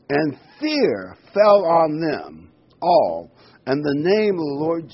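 slow, loud, weighty words; solemn slowed scripture line; slow dramatic reading. Acted. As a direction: let each word fall slowly.

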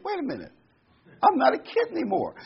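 high-pitched, playful dismay; mock realization. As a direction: voice a comic dawning alarm. Voicing sudden realization of ageing.

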